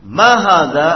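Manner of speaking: loud, clear, deliberate Arabic vocabulary reading; model reading drill. Shown as a demonstration. Teacher modelling Arabic words for students, loud and clear. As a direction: read each phrase out crisply for the class to copy. Teacher leads class repetition.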